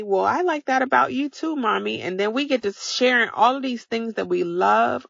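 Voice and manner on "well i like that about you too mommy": put-on higher, sweet childlike voice; kid's reply. Kids telling mom they love her too, sweet childlike. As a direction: play the kid answering Mommy. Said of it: "they get to telling me".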